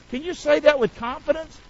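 normal talking voice

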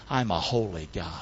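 low, soft voice of God; hushed divine declaration. Acted. Preacher voicing what God wants you to know, low and hushed.

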